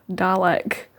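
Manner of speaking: whispered Dalek, said the way David Tennant does. Shown as a demonstration. Fan imitating David Tennant saying Dalek. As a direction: breathe the word Dalek softly like David Tennant. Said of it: "I wanna say it like David Tennant does".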